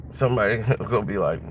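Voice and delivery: normal talking voice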